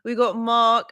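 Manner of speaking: normal talking voice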